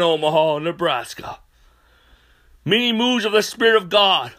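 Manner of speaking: high, loud fervent preacher voice; preaching at full force. Real, not acted. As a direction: proclaim it like a revival preacher.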